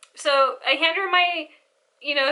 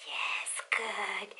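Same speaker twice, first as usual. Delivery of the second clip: whispered, wicked delight; gleeful whisper; 4 semitones lower, 13 dB quieter than usual. Retold or imitated. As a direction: hiss it like a gleeful schemer.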